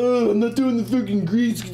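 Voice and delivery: high whiny mockery of complainers; whiny complainer impression, hammed-up; mock whiny complaining. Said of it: "Everybody's bitching and moaning".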